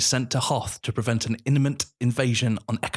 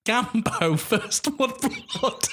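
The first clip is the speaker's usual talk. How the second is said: laughing; much slower than usual. Real, not acted. Title read through laughter.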